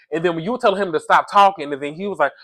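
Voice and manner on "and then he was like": ordinary talk, nothing marked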